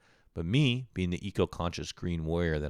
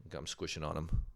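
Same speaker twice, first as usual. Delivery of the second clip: normal talking voice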